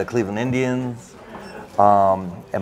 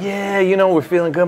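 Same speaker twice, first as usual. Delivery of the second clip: high, elated retold cheer; celebrating; 10 semitones higher, 3 dB louder than usual. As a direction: act out a team's victory whoop. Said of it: "we're celebrating".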